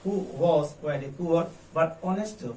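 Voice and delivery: very slow question, rising at the end; slow model read. Shown as a demonstration. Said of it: "कैसे बोलेंगे?". (धीमा प्रश्न वाचन)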